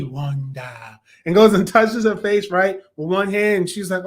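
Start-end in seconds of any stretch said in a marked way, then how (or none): 0.0-1.0 low, soft, drawn-out name; quiet, deep imitation of an actor; Paul Bettany impression. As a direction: say it low and slow, hushed. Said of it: "Does the little Paul Bettany".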